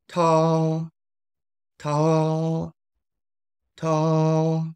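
high level first-tone "Ta". Shown as a demonstration. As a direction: keep "Ta" level at the top of the voice. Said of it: "first tone. It's at level five. It's relatively high, but it is flat".

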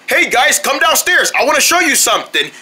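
ordinary talk

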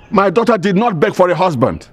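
angry, high-pitched retort; indignant. Acted. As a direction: push back with wounded family pride. Indignant father rebuffs in-law.

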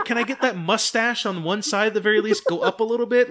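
high, loud begging through laughter; laughing plea. Retold or imitated. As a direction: coax a smirk while cracking up. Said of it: "I was like".

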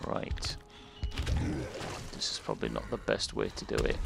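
hushed whisper. Real, not acted. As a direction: breathe it out quietly. Sneaking, whispering commentary.

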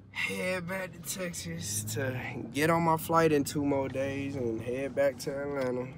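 usual speaking voice

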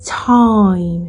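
"Time" as TAH-eem merged into one syllable; "Time" sliding to EE before the M; English time. Shown as a demonstration. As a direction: say "Time" with a full AI glide. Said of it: "อิร่วมกันอย่างรวดเร็ว".

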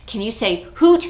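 ordinary talk

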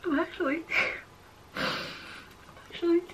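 high, choked voice on the edge of tears; tearing up. Real, not acted. Telling viewers she is tearing up, choked and high. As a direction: fight back the tears as you speak. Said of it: "actually tearing up".

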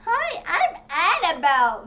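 slow, drawn-out doll voice with a laugh; creepy doll greeting. Retold or imitated. A child voicing Annabelle the doll, slow and giggly.

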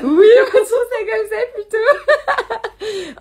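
words squeezed out through high, loud laughter; can barely speak for laughing; slow, loud laughing agreement; laughing hard. Real, not acted. Still laughing at the Maui joke.

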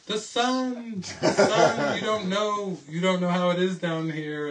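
high, loud worried complaint; complaining yell. Retold or imitated. Daughter complaining loudly to David about the sun. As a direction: warn him urgently about the sun. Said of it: "just complaining to me".

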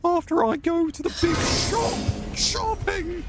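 very high, squeaky falsetto lady; shrill-free high made-up lady voice, ad-libbed; lady's voice. Pretend. Streamer improvising for chat in a high lady voice. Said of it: "say something in a lady's voice".

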